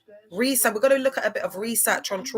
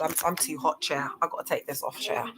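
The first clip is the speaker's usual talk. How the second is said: usual speaking voice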